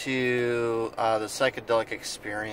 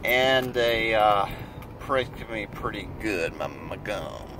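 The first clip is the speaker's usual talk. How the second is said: numb mouth, slightly slurred; talking with a numb mouth; numb from the dentist; 2 semitones lower, 3 dB quieter than usual. Real, not acted. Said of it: "this whole side of my mouth is numb".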